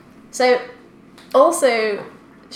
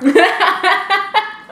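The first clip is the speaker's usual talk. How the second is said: laughing in disgust; 9 semitones higher, 7 dB louder than usual. Real, not acted. Reacting to tasting bad cheese.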